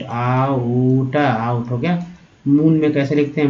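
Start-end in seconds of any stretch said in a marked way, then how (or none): 0.0-1.2 आउट stretched very slowly, "aa-oo-ta"; syllable-by-syllable pronunciation. Shown as a demonstration. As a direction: draw आउट out sound by sound. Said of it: "आउट बोलते हैं तो आउट".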